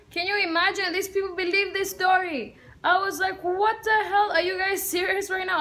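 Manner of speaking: high-pitched disbelief; incredulous. Retold or imitated. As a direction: react as if the story is absurd. Incredulous at the false story.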